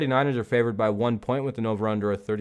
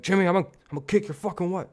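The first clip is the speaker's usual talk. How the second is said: higher, fast, hammed-up threat; mock tough-guy threat; 9 semitones higher, faster than usual. [name] hamming up a threat at his teammate, fast and high. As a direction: rattle off a fake hothead threat, higher and quicker.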